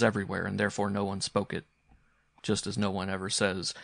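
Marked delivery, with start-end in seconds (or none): none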